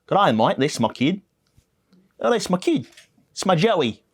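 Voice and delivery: lightly hammed-up Australian voice; Australian accent. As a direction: ham up an Aussie accent. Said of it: "'cause she was Australian".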